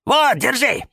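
high, excited call; eager shouting. A narrator's reading. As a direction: thrust help forward with a cry. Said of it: "закричали наперебой фикусы".

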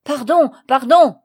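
very loud, high yelp for mercy; Léon, pinned down, screams for mercy; cry. Acted. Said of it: "Léon cria".

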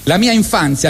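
ordinary talk, nothing marked

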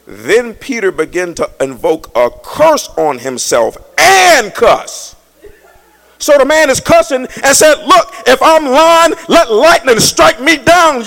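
loud, high, slow cursing cry; hollering preacher. Real, not acted. Preacher acting out Peter's cursing, full-force hollering. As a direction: shout it at the church.

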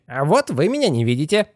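high squealing peekaboo voice; crying out. A narrator's reading. Head bobbing from under the cap, gleeful high cry. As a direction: call out childishly in a squeaky pitch. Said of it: "выкрикивал он из-под кепки".

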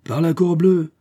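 high, loud oath; prince's forceful outburst; exclaimed oath. A narrator's reading. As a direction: burst out swearing. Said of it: "fit le prince à son tour".

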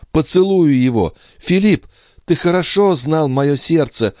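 high, fervent outpouring; emphatic gratitude. A narrator's reading. Andrea thanking her brother Philippe, high and emphatic. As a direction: stress the words with pitch held high. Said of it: "подчёркивая голосом каждое слово".

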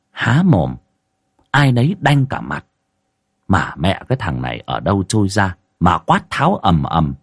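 ordinary delivery

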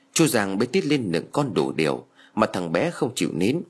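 plain reading voice

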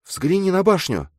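put-on high-pitched girl's voice; eager girl character. A narrator's reading. Girl shows her friend the tower, eager. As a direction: draw his attention in a high feminine voice.

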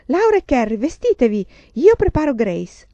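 loud, high motherly orders; brisk calling out. A narrator's reading. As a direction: call the girls to get ready at once. Mother calls instructions to her daughters. (richiamo sollecito)

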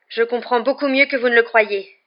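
heated, emotion shaking the voice; increasingly trembling. A narrator's reading. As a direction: snap back with an unsteady voice. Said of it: "d'une voix qui tremblait de plus en plus".

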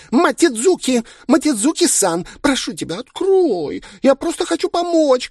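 put-on very high pleading girlish shout. A narrator's reading. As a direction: wail at the locked door. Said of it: "кричала староста". (пронзительный умоляющий крик)

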